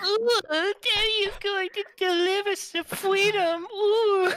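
very high, slow hammed-up squeal; donation read in silly voice. Reading a viewer donation aloud on stream, hammed-up squeal. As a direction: ham up the donor's message in a squeaky voice. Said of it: "All right, Dave, let's hear it".

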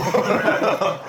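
burst of laughter. Real, not acted. Laughing at own story.

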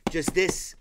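ordinary delivery

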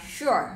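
"sure" opening on a clear, heavy SH; heavy sh sound. Shown as a demonstration. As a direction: model "sure" with a hushing sh. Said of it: "âm sure nặng". (đọc "sure" với âm sờ nặng)

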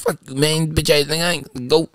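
a hint of a mumbling crackhead. Guard retelling the Rite Aid thief, mumbled crackhead voice. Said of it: "you know how crackheads talk".

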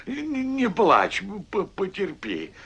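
calm the crying hare gently; soothing hush. Acted.

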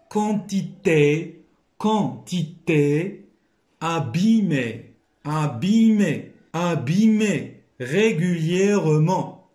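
teacher reviewing the dictation words slowly; slow emphatic recap. Shown as a demonstration. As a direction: hammer home each word for the class. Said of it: "review of the words".

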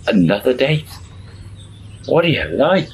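high-pitched retold exasperation; scandalised friend, lightly acted; mock disbelief. Friend reacts to another date.

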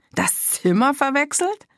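mocking repeat, pitch shooting up on verwechselt; incredulous echo. A narrator's reading. Mother repeating her daughter's excuse, scornful and high. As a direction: throw the words back in disbelief. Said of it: "wiederholte Mathilda und schnaufte".

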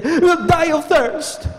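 high, slow whiny complaint; mocking grumbler. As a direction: whine like the thirsty Israelites. Preacher voicing grumbling people.

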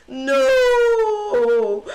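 loud, high anguished shout. Real, not acted. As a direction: yell it in real despair. Realizing the blunder.

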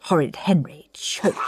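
plain reading voice

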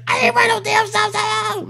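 mock furious high-pitched shout; hostile yelling. Driver denying she ran the stop sign, mock high shrieking. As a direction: squeal it loudly as an outraged driver. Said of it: "immediately she's hostile".

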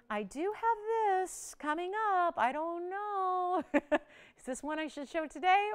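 high, slow singsong tease; teasing hesitation. Real, not acted. Host teasing viewers with the next item, teasing hesitation. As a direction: stretch it out high, dangling the offer.

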